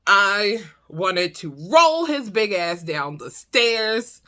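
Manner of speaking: normal talking voice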